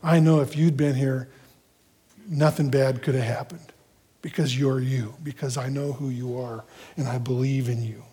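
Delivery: soft, low heartfelt confession; tender reverence without blame; trusting devotion. Acted. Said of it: "she's just expressing this".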